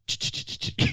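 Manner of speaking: rapid clicking dial noise; imitated rotary dial. Showing how dialing the old phone sounded, imitated clicks. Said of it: "if you're dialing the phone".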